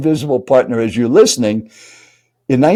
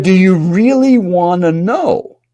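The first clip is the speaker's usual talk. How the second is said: higher, slow, loaded question; imitated challenging tone; 6 semitones higher, slower than usual. Stranger dares his seatmate to ask, higher and drawn out. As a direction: draw it out as a mock challenge. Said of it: "In that tone of voice".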